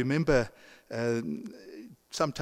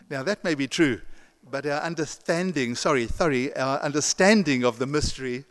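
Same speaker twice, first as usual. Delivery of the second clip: ordinary delivery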